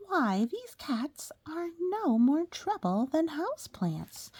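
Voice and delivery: Norma, happy and slow; said happily. A narrator's reading. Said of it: "she said happily".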